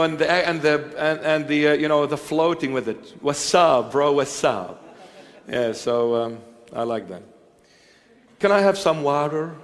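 ordinary talk

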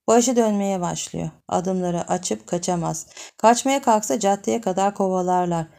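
usual speaking voice

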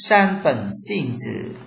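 ordinary delivery

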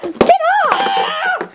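high, drawn-out yell; yelled order. Acted. Shoving someone away in a skit, high yell. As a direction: yell it high and stretch the end. Acted scuffle.

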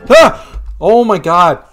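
loud frightened yelp; startled scream. Real, not acted. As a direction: jump out of your skin. Horror game jump scare.

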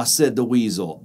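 ordinary delivery, nothing marked

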